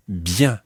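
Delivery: "bien" ending in a nasal vowel; nasal French vowel. Shown as a demonstration. Teacher introduces word for well, "bien" with nasal vowel. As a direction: end "bien" through the nose, no hard n. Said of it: "is another nasal sound".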